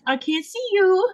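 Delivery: high, slow mock voice; imitating Jaja. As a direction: squeak it out like Jaja. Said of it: "Jaja's like".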